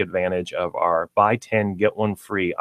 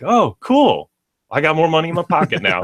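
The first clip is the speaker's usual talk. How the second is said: happy customer; 8 semitones higher, 4 dB louder than usual. Retold or imitated. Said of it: "you're like".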